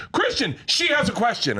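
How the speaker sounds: high call to the player. Retold or imitated. Reporter calling a player for another reporter. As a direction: shout across to him. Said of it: "And I went".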